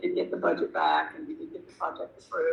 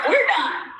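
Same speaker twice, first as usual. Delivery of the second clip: higher, louder curt dismissal; imagined blowup; 11 semitones higher, 5 dB louder than usual. Retold or imitated. Imagining ending the meeting abruptly, curt and loud. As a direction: snap the meeting shut. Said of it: "I would have slammed the thing shut".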